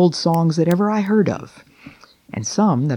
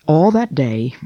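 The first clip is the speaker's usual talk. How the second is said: plain reading voice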